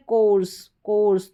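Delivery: कोर्स with ओयू as a long "o"; "o" sound. Shown as a demonstration. Teacher repeats an exception for students, "o" sound. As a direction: repeat कोर्स with a round "o" vowel. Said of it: "ओ करके हो रहा है".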